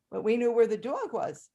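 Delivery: ordinary talk, nothing marked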